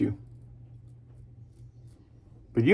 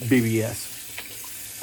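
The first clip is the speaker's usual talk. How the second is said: drawn out initials; 5 dB quieter, much slower than usual. Real, not acted. Drawn out "baby butt smooth.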